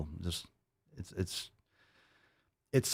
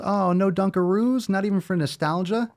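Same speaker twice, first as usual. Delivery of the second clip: mock sweet employee voice; 10 semitones higher than usual. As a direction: imitate the clerk's syrupy coaxing. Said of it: "and she goes".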